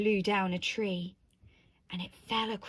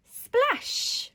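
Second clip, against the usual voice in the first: high, loud storybook sound word; big climactic exclamation; dramatic splash; 13 semitones higher, 5 dB louder than usual. A narrator's reading. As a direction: burst out with the splash.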